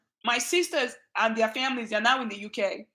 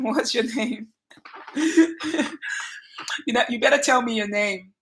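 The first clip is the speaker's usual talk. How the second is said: ordinary talk, nothing marked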